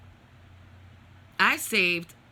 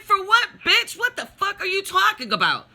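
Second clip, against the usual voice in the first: loud, very high-pitched indignant yell; retold argument at full outrage; outraged retort; 14 semitones higher, 7 dB louder than usual. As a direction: fire back in shrieking disbelief. Retold argument with brother escalates.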